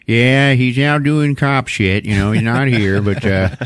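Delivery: slow, drawn-out cop voice; other cop. Retold or imitated. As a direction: play a cop dragging out the words. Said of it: "some other cop is like".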